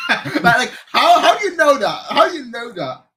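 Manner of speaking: retold high, loud disbelief; astonished. Streamer retelling shock at his birthplace being guessed from his accent, astonished. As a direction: pitch leaps up in mock-incredulous amazement.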